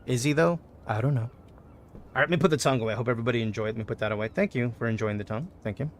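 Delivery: normal talking voice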